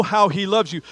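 ordinary talk

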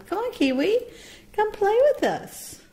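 high, sing-song coaxing to a pet; coaxing call. Real, not acted. Owner calling Kiwi to come play, high coaxing call. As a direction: lure the bird over with a bright high call. Calling the shy bird over.

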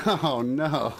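real laugh breaking into oh no. Real, not acted. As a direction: giggle through the words. Laughs at sugar plum info.

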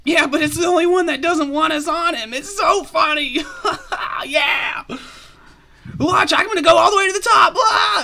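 high, loud squeaky squirrel voice; giddy squirrel. Acted. Squirrel showing off before climbing the treant, high and giddy.

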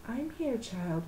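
put-on soft, gentle old woman; soft grandma. A narrator's reading. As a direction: say it softly to comfort. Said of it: "Grandma said softly".

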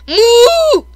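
loud, high-pitched mock moo; cow moo. Imitates a cow at moo citizen.

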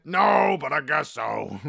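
a mock shouted "No," then a grudging rest. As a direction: bark the refusal, then give in. Acted grudging reply to waitress.

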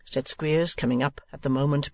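ordinary delivery

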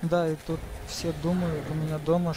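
ordinary delivery

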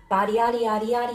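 ordinary talk, nothing marked

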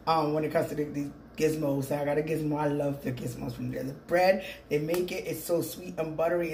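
ordinary delivery

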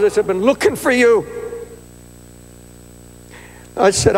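loud, fervent preaching through the whole story; full-force preaching. Acted. Preacher retells apologizing to the fired couple, fervent preaching. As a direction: preach it out to the back row.

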